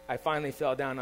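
ordinary delivery

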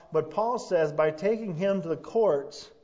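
ordinary talk, nothing marked

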